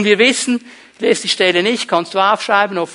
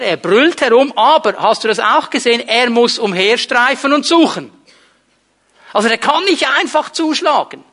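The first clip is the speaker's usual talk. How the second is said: high, loud, insistent sermon peak; fervent preacher rallying the congregation; preaching at full force; 5 semitones higher, 5 dB louder than usual. Acted. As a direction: hammer home that we need not fear. Preacher building to climax.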